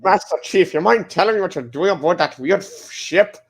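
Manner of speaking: hammed-up Scottish Connery accent; Sean Connery impression. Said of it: "is he trying to do a Sean Connery impression?".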